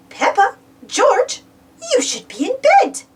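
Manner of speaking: high granny voice; granny gently scolding the piglets; Granny Pig voice. A narrator's reading. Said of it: "said Granny Pig".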